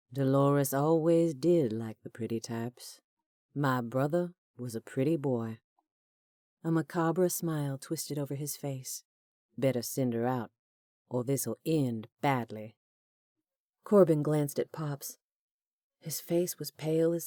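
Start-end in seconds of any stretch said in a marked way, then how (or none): 0.1-5.6 mock Southern accent. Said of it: "parody of refined Southern accent". 9.6-12.8 low menacing villain. Acted. Villain threatening calmly.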